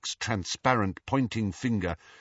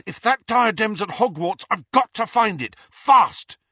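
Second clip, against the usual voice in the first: raised, forceful urgency; fierce; 11 semitones higher, 8 dB louder than usual. A narrator's reading. Harry presses the ghost about the diadem, fierce. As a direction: drive it home, hardest on the last word. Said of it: "he said fiercely".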